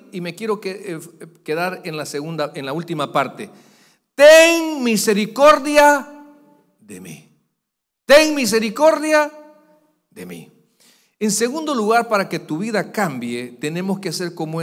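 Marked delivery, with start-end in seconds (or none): 4.2-10.5 loud, high, stretched plea; the blind man shouting to Jesus; crying out for mercy. A narrator's reading. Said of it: "comenzó a dar voces". (clamor fuerte y agudo pidiendo misericordia)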